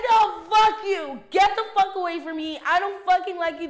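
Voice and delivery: angry yell. Retold or imitated. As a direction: scream at the dog to stay away. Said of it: "She said".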